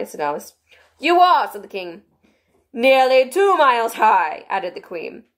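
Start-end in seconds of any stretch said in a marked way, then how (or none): 1.0-1.5 high, loud king voice. A narrator's reading. 2.7-4.4 slow, high, loud queen. A narrator's reading. Queen voiced in reading.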